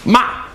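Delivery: MA starting high and dropping sharply; short, sharp fall on MA. Shown as a demonstration. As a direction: drop MA fast from high, as in scolding. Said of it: "goes down sharply".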